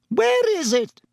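very high, piping reedy voice; reedy official. A narrator's reading. Committee member finds the animal missing, reedy astonishment. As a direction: pipe the question up high. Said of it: "said the reedy voice of the committee member".

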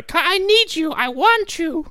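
very high, pleading mock-girl voice; lovesick girl. Girl phoning her boyfriend, hammed-up lovesick pleading. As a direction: gush it in exaggerated falsetto. Girl's lovesick phone call, acted.

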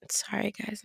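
soft unvoiced whisper. Real, not acted. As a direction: whisper an apology to listeners. Sudden whispered apology.

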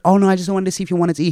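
high, rushed imitation of her; put-on high, quick innocent voice; mock innocent excuse.

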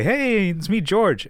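high-pitched, enthusiastic phone greeting; George, enthusiastic hi. Retold or imitated. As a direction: greet her eagerly, pitch way up. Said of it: "enthusiastic hi".

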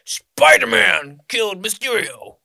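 loud, high, drawn-out mock announcement; hammed-up news bulletin shout; Daily Bugle impression. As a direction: blare it like a tabloid newsman. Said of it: "You got the Daily Bugle talking about".

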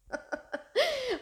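a real, high, short laugh at herself. Real, not acted. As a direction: burst into a high laugh, then let it fall. Laughing at her own crying.

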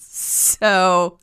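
ordinary delivery

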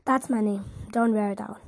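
plain reading voice

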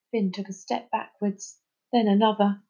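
usual speaking voice

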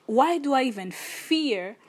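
ordinary delivery

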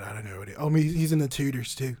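ordinary talk, nothing marked

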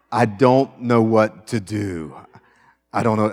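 slow, loud, lost voice; acting the freezer. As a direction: play someone stuck and unsure. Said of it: "Okay, I'm a freezer".

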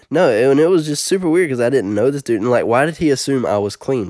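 ordinary talk

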